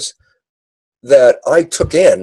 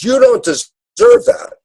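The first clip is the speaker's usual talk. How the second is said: higher, slow dad's verdict; a drawn-out, higher fatherly reproach; stern dad; 12 semitones higher than usual. Retold or imitated. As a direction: lay it down slowly as a father. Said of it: "the dad says".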